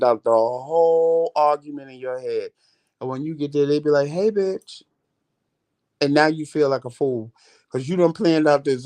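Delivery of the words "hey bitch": higher-pitched, friendly mock greeting; lightly acted, high-pitched hello; imitated casual greeting. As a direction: voice the other person's carefree hello. Friendly greeting defusing the imagined argument.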